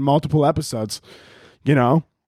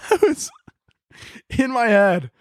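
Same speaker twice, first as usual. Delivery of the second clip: high, squeezed words through laughter; laughing; much slower than usual. Real, not acted. Podcaster cracking up at his own thought, laughing. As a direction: struggle to talk while cracking up.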